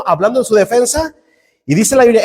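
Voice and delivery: usual speaking voice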